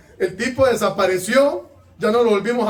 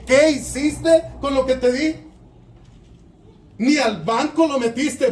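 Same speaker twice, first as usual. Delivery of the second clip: scolding lord, raised and indignant; stern angry master; 5 semitones higher than usual. Acted. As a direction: berate the lazy servant. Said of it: "hoy he gritado un poco de más".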